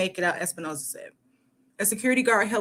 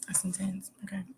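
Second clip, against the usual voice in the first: whisper; 12 dB quieter than usual. Real, not acted. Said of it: "whispered reaction".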